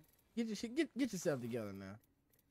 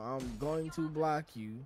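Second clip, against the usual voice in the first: ordinary talk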